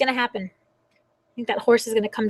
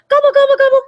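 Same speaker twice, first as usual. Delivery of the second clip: high, loud turkey voice; turkey gobble; 14 semitones higher, 10 dB louder, faster than usual. Acted. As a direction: gobble like an excited turkey for the kids. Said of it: "I sound like this".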